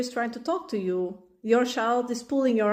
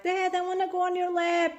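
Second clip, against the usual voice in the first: put-on higher little kid voice; child asking; 9 semitones higher than usual. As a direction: ask like a small child wanting a lap.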